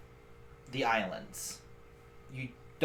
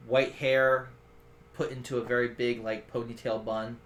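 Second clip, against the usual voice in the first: normal talking voice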